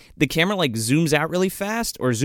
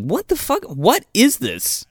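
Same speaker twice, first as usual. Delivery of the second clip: high-pitched disbelief, slowing at the end; mock bafflement; 7 semitones higher, slower than usual. Viewer puzzled by a stack of folders, incredulous. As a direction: stretch out the baffled question.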